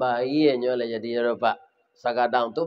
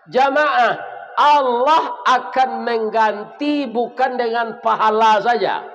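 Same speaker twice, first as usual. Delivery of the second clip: raised, higher call to the congregation; fiery preaching; 6 semitones higher than usual. Acted. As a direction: stretch and hammer the exhortation. Preacher exhorts congregation loudly.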